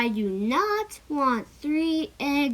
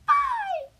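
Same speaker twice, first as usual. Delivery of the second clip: high bird farewell. Acted. Bird flies away.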